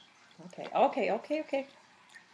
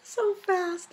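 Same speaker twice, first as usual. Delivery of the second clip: high cooing pet voice; 9 semitones higher than usual. Real, not acted. Talking to the pet, high baby-talk coo. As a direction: coo at the animal in a sing-song.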